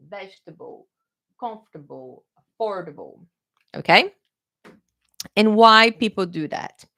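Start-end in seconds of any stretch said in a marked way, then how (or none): 0.1-3.2 vegetable, comfortable, affordable softly, endings swallowed; VEJ-tuh-bul, KUMF-tuh-bul, uh-FOR-duh-bul; stress on the beginning. Shown as a demonstration. Teacher shows where stress should go, early stress. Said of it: "should be stressing the beginning".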